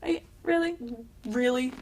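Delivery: high-pitched, quick mock outrage; incredulous retold rant; indignant disbelief. Retold indignant outburst at being rejected.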